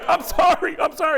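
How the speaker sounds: high, loud flustered sorry; high frantic apology; panicked apology. Retold or imitated. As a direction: beg the crowd's forgiveness. Comic apologizing to crowd.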